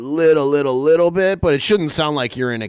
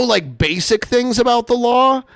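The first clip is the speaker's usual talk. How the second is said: usual speaking voice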